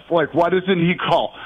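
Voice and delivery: ordinary talk, nothing marked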